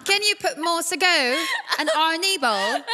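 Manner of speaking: put-on imagined son's polite British accent. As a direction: order politely in hammed-up British English. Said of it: "pero British accent siya".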